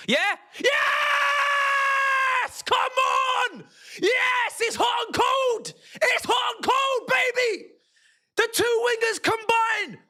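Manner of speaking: real, high-pitched shouting at a goal; yelling in delight at the goal; goal celebration shout. Real, not acted. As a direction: scream it out as the goal goes in. Goal celebration during live watch.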